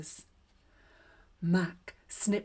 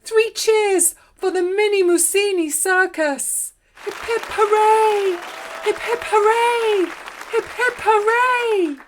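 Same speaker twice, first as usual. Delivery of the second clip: crowd cheering; 13 semitones higher, 13 dB louder than usual. Acted. Audience cheers the circus.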